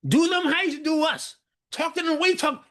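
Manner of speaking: put-on high, sulky child's complaint; mad child. A grandchild to a grandmother, high sulky complaint. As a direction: sulk like a grandchild stuck in the corner. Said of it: "the child is, like, in the corner mad".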